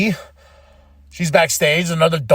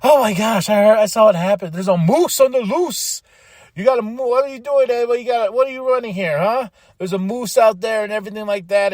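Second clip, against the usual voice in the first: higher, breathless excited chatter; excited gossip; 5 semitones higher, 3 dB louder than usual. Retold or imitated. Chelsea bursting in on Ava, higher and excited. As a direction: rattle it off like breaking hot news. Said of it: "Beautiful Chelsea's like".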